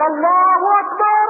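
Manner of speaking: high, loud cry of God is great; loud takbir. Retold or imitated. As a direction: call it out high, in triumph. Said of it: "فكبر النبي صلى الله عليه وسلم".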